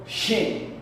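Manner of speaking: teacher demonstrating Shen. Shown as a demonstration. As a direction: breathe out the sh of Shen. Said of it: "Aquí decimos".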